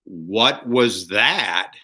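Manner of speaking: slow, drawn-out disbelief; slow, incredulous husband's question; baffled question. Acted. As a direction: space out every word, utterly puzzled. Husband baffled by wife's mime.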